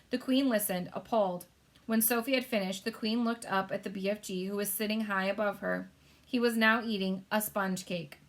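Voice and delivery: usual speaking voice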